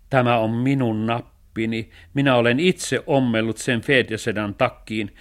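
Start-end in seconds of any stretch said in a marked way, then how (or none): none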